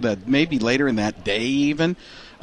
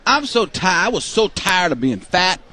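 lightly higher folksy ad voice; Terry Bradshaw impression; 5 semitones higher, slower than usual. Comedian mocks Bradshaw's Nutrisystem ad, whiny impression. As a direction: whine it like a diet ad. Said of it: "The Terry Bradshaw is the Nutrisystem".

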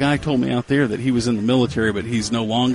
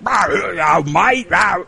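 mock Cockney; 6 semitones higher, much slower than usual. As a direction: bark it like a London geezer, Cockney. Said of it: "Cockney, East Ender stuff".

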